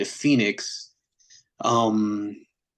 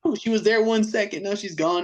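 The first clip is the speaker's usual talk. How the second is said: puzzled; 9 semitones higher than usual. Retold or imitated. Searching for the missing bassist.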